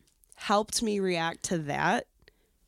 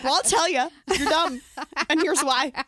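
blunt friend; 7 semitones higher, 6 dB louder than usual. Retold or imitated. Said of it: "she's like".

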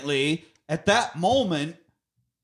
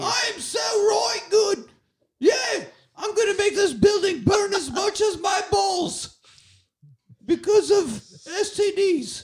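put-on kid's British accent. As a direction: bellow it like a bratty British kid. Continues kid voice.

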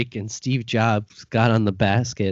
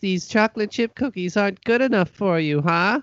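put-on high, hurt old granny; wounded granny guilt trip; grandma voice; 7 semitones higher than usual.

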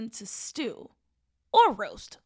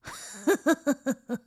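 evil cackle; 2 semitones higher, 4 dB louder than usual. Acted. Witch laughs after threat.

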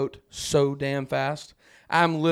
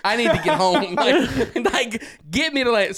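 laughing plea; 6 semitones higher, 4 dB louder than usual. Retold or imitated.

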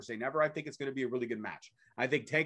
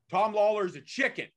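higher taunting promo voice; wrestler promo; 5 semitones higher, 3 dB louder than usual. Retold or imitated. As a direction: sneer it like a wrestling heel. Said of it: "Now we get a Von Erich promo".

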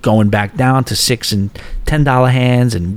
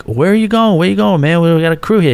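urgent plea; 7 semitones higher than usual. Retold or imitated. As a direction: beg him not to leave the table. Pleading with a leaving player.

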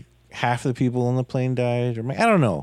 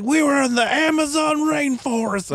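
put-on much higher Australian-ish voice of the old pilot; pitched-up Australian accent for the pilot; Australian accent impression; 13 semitones higher than usual. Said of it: "Was that Australian?".

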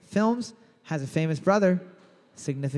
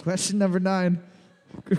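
usual speaking voice

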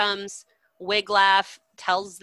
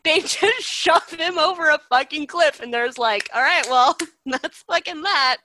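laughing; 9 semitones higher, 7 dB louder than usual. Real, not acted. Laughing at the absurd ending.

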